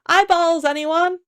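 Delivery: high street-vendor style call. A narrator's reading. Trader calling across the graveyard for eyeballs, high call. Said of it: "came a call".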